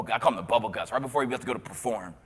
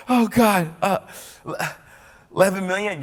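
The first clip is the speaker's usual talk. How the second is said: higher, louder, stunned gasp of a question; can't believe the number; shocked disbelief; 7 semitones higher, 6 dB louder than usual. Retold or imitated. Shocked at eleven million viewers.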